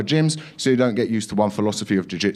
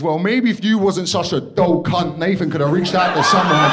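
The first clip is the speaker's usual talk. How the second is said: inner rant; 9 semitones higher, 4 dB louder than usual. Retold or imitated. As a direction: spit out the silent thought, then shout. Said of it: "what I'm thinking is".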